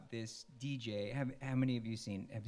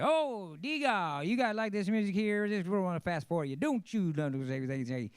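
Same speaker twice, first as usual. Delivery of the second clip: much higher, louder, fast rattling DJ patter; imitating a wild 1950s Memphis DJ on air; Dewey Phillips auctioneer patter; 9 semitones higher, 8 dB louder than usual. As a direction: rattle it off like a hyped-up radio auctioneer. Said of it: "he talked like an auctioneer".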